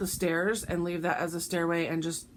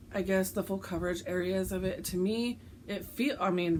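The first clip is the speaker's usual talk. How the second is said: ordinary talk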